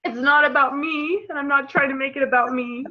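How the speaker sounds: real crying through the words; fighting back tears; crying while insisting it's not about her; tearful. Real, not acted. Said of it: "And I'm gonna get teary again".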